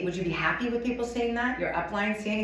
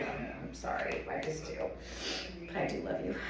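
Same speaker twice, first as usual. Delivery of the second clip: quiet aside; 3 semitones lower, 12 dB quieter than usual. Real, not acted. Quiet apology aside to audience.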